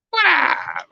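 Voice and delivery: high, drawn-out goofy greeting; mock what-up guy. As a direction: squawk it out as a hammed-up greeting. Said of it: "You gotta be like a".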